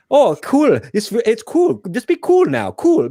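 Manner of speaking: high, exaggerated Icelandic accent on cool; mock Icelandic accent. Podcaster mimicking the film's Icelandic accent, high and hammy. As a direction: ham up an Icelandic lilt, pitched well up. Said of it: "you remove the accent from that".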